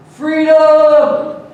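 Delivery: high, loud drawn-out rallying yell; lone voice shouting out, long and high; yelled cry. Retold or imitated. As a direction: yell it out across a crowd. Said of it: "somebody just yells out".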